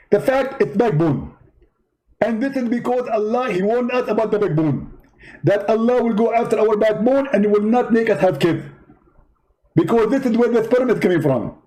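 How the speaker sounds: high, indignant preacher voice; Zakir Naik impression. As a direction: play the offended debater, pitched well up. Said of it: "Zakir Naik?".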